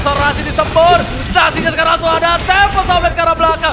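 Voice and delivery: loud, high hyped shouting; excited esports caster. Real, not acted. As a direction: yell the play-by-play. Hype caster shouting the play.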